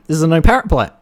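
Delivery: high, loud mock-indignant rant; mock outrage. Captain berates the woman with the parrot, loud mock outrage. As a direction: scold her like an outraged captain.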